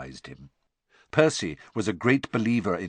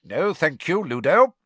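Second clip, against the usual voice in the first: higher, firm polite refusal; bite of impatience; 7 semitones higher, 7 dB louder than usual. A narrator's reading. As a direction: decline with a touch of impatience. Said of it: "there was a bite of impatience".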